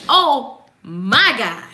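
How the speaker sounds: loud, slow, relishing every word; excited proclamation. Shown as a demonstration. Claiming it for the listeners, excited and emphatic. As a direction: proclaim it with big, drawn-out excitement. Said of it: "be excited about it".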